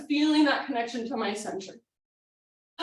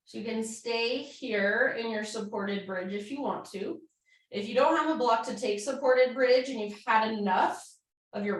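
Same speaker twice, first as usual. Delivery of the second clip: soothing yoga cue; 3 semitones lower, 4 dB quieter than usual. Acted. Calm cool-down cueing.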